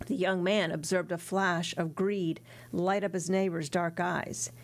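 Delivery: ordinary delivery, nothing marked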